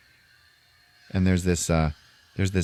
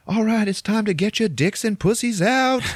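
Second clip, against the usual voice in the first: high, louder party-DJ shout; mock DJ hollering into mic; hype DJ yell; 14 semitones higher, 4 dB louder than usual. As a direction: yell it to the whole room. DJ yelling into microphone.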